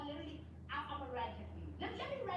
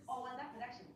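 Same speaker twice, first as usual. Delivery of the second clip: normal talking voice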